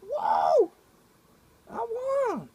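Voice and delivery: very high, loud whoops of a win; imitating brother's wild cheering over a Monopoly win; brother's victory whoops. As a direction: leap up whooping like you just won. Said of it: "I remember him jumping up going".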